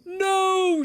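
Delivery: high, loud refusal; shouted no. A narrator's reading. Toad refuses to chase his list, high shouted no. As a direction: shout it out in alarm. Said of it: "shouted Toad".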